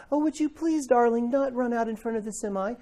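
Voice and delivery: high, sugary, too-gentle plea; overly sweet politeness. Acted. Parent asking a child sweetly while danger approaches, mock sweetness. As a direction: ask far too nicely for an emergency. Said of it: "That's not gonna get their attention".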